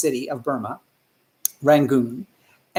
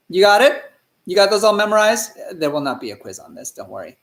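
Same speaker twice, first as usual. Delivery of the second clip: usual speaking voice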